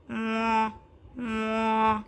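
long moo; cow moo. Acted. Narrator voicing the cow, mooing. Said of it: "The cow said".

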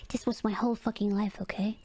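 low-pitched, dropped-voice insistence; low retort. Real, not acted. Gamer defending her past with Warcraft, low retort. Retorts after mimicking surprised people.

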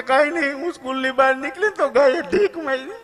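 hammed-up wail of the injured teacher; crying teacher. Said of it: "બેન રોતા રોતા બોલ્યા". (ગાયે માર્યા પછી રડતી શિક્ષિકાનો ખૂબ ઊંચો, હસતો અવાજ)

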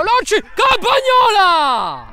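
high, loud, stretched shout; excited save call. Real, not acted. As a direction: cry out the save. Penalty saved by keeper.

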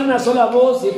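ordinary talk

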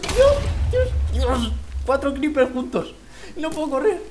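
high, loud panicked yelps at the creepers; panicked cries. Real, not acted. Gamer cornered by four creepers, panicked high yelling. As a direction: shriek as the creepers close in.

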